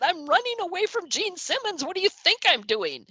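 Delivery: high, exasperated shout-back; running downstairs, answering her friend in a high, frantic voice; frantic reply. Retold or imitated. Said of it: "I said".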